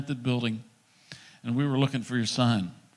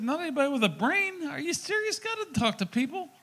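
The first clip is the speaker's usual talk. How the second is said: high-pitched, incredulous mocking voice; incredulous scoffing; 13 semitones higher than usual. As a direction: scoff in a much higher voice, astonished. Acts out wife scoffing, incredulous.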